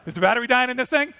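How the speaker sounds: ordinary talk, nothing marked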